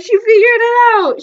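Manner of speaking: high, loud laughing cry; excited squeal. Real, not acted. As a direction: squeal in embarrassed delight. Prank exposed.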